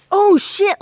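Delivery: sudden alarmed outburst; startled. Real, not acted. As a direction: caught off guard by wasps. Sees a wasp nest.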